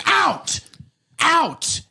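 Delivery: mock-yell to chase his family away; furious shout.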